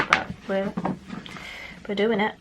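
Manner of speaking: ordinary talk, nothing marked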